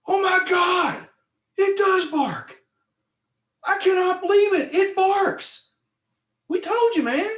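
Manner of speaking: high, loud disbelief, slowly at first; amazed shout. Retold or imitated. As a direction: shout it like you can't believe your ears. Bill amazed the fish barks.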